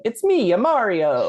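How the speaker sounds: put-on high, hammy Italian plumber accent; chirpy Italian accent, played for laughs; Italian Mario voice. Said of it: "someone going".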